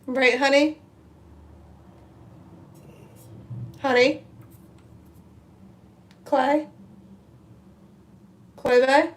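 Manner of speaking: wife calling her fiance in the next room, rising calls. Real, not acted. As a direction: call out to someone who can't hear you.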